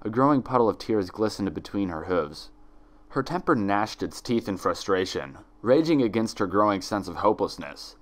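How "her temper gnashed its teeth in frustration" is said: ordinary delivery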